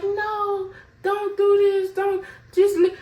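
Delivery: begging through tears, lightly imitated; imitating crying friend. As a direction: plead high and tearful like a crying friend. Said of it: "she's horribly crying".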